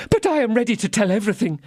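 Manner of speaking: high, hurried, pleading little shopkeeper; frightened Bonacieux. Acted. Bonacieux pleads with the commissary, high and fast. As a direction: rush to prove you'll cooperate.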